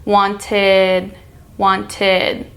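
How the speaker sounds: "Wanted" ending in a light "id"; "Wanted" with the tongue tip on the roof of the mouth for "id". Shown as a demonstration. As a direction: end "Wanted" with an open "id". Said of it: "the tongue ends at the tip".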